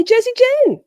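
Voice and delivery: high, loud delighted call-out; excited greeting. Real, not acted. Host greeting a returning chatter, high and delighted.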